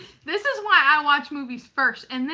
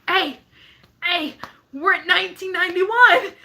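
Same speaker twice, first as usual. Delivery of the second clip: raised, calling-out milestone update; excited announcement; 4 semitones higher, 5 dB louder than usual. Retold or imitated. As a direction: call over to a distracted friend. Retells excitedly calling out subscriber count.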